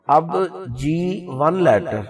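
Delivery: ordinary talk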